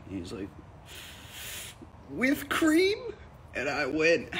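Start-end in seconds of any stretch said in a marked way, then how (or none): 2.1-3.0 very high, rising imitation of an eager barista; eager setup question. Barista feeding the comedian his setup, eagerly. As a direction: pitch it way up, expectantly.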